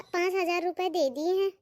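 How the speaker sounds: ordinary talk, nothing marked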